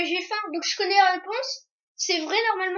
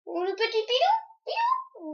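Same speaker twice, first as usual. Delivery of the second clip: put-on baby talk; 5 semitones higher, 5 dB quieter, slower than usual. Talking to cute dog.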